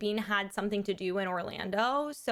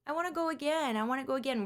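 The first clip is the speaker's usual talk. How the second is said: put-on little kid begging; 4 semitones higher, faster than usual. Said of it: "he went".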